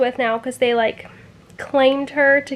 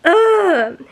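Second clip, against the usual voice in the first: disgusted ugh; 6 semitones higher, 4 dB louder than usual. Real, not acted. Angry at the book.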